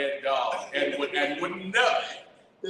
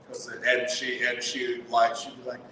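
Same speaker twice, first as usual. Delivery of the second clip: usual speaking voice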